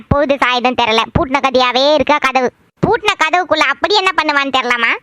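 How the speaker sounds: put-on low-pitched, griping old relative; grumbling mother-in-law. Mother-in-law complains to visiting girl, low grumbling. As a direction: grumble about the daughter-in-law.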